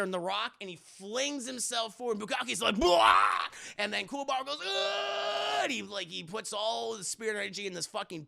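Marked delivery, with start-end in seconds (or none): 2.8-3.5 loud cartoon roar of alarm; monster yell. Monster as the hero flings himself at him, loud roar. 4.6-5.6 high, drawn-out effort cry; straining yell. Retold or imitated. Hero putting all his energy in a punch, high straining yell.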